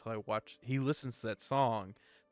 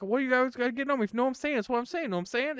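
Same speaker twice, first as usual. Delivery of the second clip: higher, repeating know I'm saying in a rising lilt; a lightly hammed-up wannabe gangster, much higher; wannabe rapper impression; 13 semitones higher, 5 dB louder, much faster than usual. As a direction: play the try-hard trailer park rapper. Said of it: "And he's just like".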